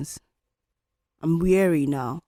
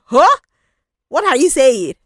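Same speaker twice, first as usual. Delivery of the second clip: much higher, louder incredulous huh; mock shocked listener; 7 semitones higher, 10 dB louder than usual. Imagined podcast listener reacting in mock shock. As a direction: play a stunned listener reacting.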